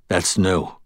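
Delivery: understated, emptied-out remark; numb. A narrator's reading. Stunned Murphy reacts to the news, numb voice. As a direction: say it without feeling. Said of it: "her voice numb".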